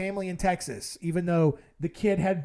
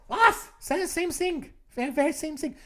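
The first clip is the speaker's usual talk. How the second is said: put-on German accent; 8 semitones higher than usual. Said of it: "this increasingly German accent you're talking with".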